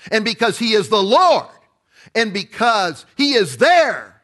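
rising sermon cadence, every phrase landing hard; preaching at full force. Acted. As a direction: proclaim it like a revival preacher.

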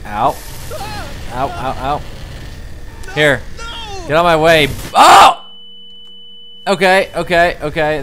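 getting a leg cramp. Pretend. Character gets hurt.

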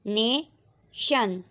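नेशन stretched out very slowly; very slow blended word. Shown as a demonstration. Teacher blending syllables, very slow read. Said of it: "second part शन".

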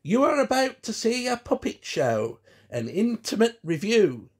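high, loud showman's announcement; William announcing his show. A narrator's reading. William introduces his puppet show from behind the theatre, announcing loudly. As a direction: announce it grandly to the audience. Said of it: "William's voice came through the front".

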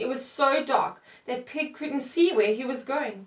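plain reading voice

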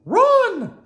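very high, loud cartoon yell; shout. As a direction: scream the warning at full voice. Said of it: "shouted Mickey".

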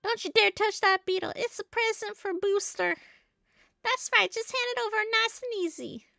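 high cartoon henchman voice; bossy game henchman. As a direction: order it in a high put-on voice. Voicing the beetle catchers' demand.

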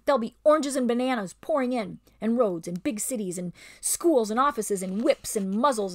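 smooth over the lie; ape's bullying voice. Acted. Ape soothes the beasts with false promises.

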